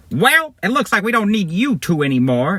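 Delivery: high, irritating put-on henchman; annoying villain voice. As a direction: dismiss them in a high, grating character voice. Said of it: "Guy one's annoying voice".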